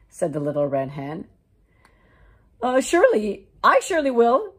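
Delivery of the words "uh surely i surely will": loud, high, eager yes; eager dog. Acted. The dog eagerly claiming the bread, loud. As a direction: jump at the chance.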